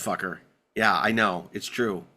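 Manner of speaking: usual speaking voice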